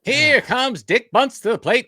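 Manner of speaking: loud, high, slow vintage baseball call; old-timey radio announcer. Acted. Co-host mimicking the host's reading, as an old-timey announcer. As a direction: belt it out like old radio play-by-play. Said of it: "like an old-timey radio announcer".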